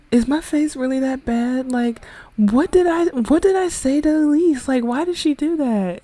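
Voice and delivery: high, plaintive acted questioning; upset slapped girl. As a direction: voice a wronged girl pleading to know why. Upset slapped girl asking Darian, voiced.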